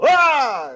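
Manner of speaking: high, loud mock shriek; mock scream. Visitors shown a body under the sheet, mock scream. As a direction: imitate a horrified "ah!".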